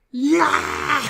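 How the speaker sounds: high, loud, drawn-out mock cheer; imitated crowd cheer. Audience answering the presenter on stage, mock roaring cheer.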